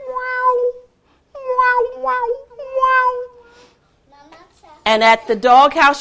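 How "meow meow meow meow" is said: high meows; cat meowing. Acted. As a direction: meow like a cat far off in the grass. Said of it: "she heard the cat".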